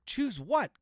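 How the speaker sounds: high-pitched challenging question; incredulous question. A narrator's reading. Floyd challenges the priest's words, incredulous. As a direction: demand an explanation, pitch jumping up.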